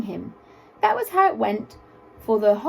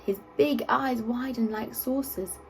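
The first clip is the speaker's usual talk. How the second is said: ordinary delivery, nothing marked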